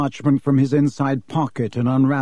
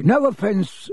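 quick apology; 7 semitones higher, slower than usual. Acted. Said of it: "Mister Borgin said quickly".

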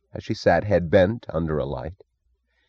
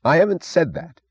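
high, sharp denial; curt, raised-pitch retort; sharp snap; 11 semitones higher, 3 dB louder than usual. A narrator's reading. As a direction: snap back defensively. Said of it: "he snapped sharply".

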